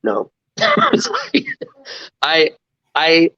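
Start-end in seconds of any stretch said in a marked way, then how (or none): 0.6-1.6 burst of high laughter. Real, not acted. Laughing off the sports question.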